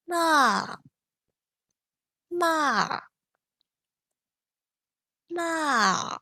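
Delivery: "Ma" falling sharply from high; fourth tone ma. Shown as a demonstration. Teacher showing the fourth-tone "Ma". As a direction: snap "Ma" straight down. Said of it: "try with the ma sound".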